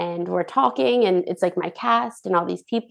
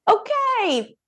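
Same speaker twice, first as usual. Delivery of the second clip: high, hurt "fine" that sinks down; rebuffed, answering the grumpy cast in mock hurt; mock offended; 7 semitones higher, slower than usual. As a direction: brush it off with wounded cheer, then let it drop.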